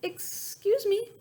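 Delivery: high, slow tiny voice; a small bird calling politely; little voice. A narrator's reading. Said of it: "a little voice called out".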